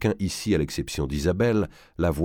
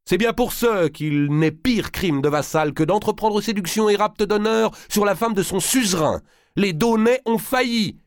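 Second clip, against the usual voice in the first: high, loud, rising fury; indignant outcry; 4 dB louder than usual. A narrator's reading. Valois demands punishment of the vassals, a high indignant outcry. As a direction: condemn them with mounting outrage. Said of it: "s'écria Valois".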